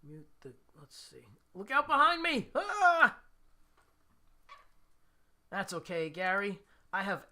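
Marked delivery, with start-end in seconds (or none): 1.5-2.5 loud, high cry of alarm, hammed up; shouted fake panic; mock alarm. Plays startled at viewer's warning. 2.6-3.2 loud, high startled scream, put on; hammed-up yelp of fright; play-acted shriek of alarm; mock scream. Plays startled at viewer's warning.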